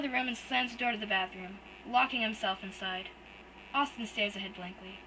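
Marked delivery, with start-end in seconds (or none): none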